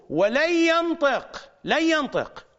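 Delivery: slow, high, heavy stress on ينطق; emphatic preaching. Acted. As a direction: hammer each word of ولن ينطق slowly. Preacher stresses the Imam's words. (نبرة وعظية مشددة على ينطق)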